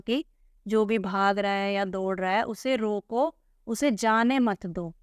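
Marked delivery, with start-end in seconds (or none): none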